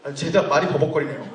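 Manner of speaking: normal talking voice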